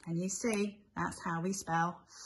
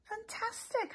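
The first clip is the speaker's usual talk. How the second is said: delighted; 12 semitones higher, 4 dB quieter than usual. Pretend. Self-praise after spelling it.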